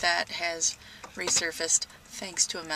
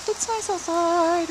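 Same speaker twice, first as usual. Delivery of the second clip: cat talk; 9 semitones higher, 6 dB louder, slower than usual. Real, not acted. Cooing to the cat.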